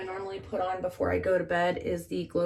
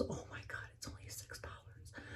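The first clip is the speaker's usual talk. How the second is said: hushed, amazed whisper; awed whisper; 20 dB quieter than usual. Real, not acted. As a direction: breathe it like a secret. Whispered repeat of price.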